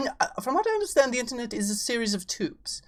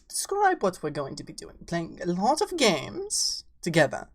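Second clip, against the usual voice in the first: ordinary delivery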